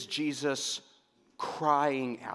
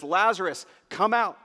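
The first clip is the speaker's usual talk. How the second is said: Jesus calling out, higher and louder; crying out; 5 semitones higher, 5 dB louder than usual. Retold or imitated. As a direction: summon a man out of his tomb. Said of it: "Jesus cries out at the tomb".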